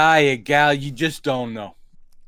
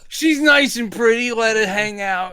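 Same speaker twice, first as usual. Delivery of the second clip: higher, goofy comedian whine; Jerry Lewis; 5 semitones higher than usual. Acted. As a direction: plead like a silly overgrown kid. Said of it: "Nice and Pretty was verging into Jerry Lewis".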